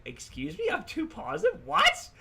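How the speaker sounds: high, rising, mock offended; indignant surprise. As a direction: squeak it out in disbelief. Acting his indignant reply.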